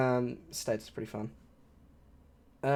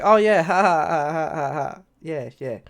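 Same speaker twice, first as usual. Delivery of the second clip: pretending to listen; 2 semitones higher, 5 dB louder, slower than usual. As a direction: fake laugh tailing off. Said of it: "you don't listen".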